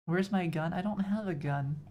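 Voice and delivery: normal talking voice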